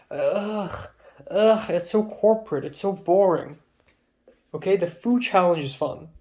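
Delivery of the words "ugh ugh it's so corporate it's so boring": disgusted groan. Real, not acted. Griping about boring club activity.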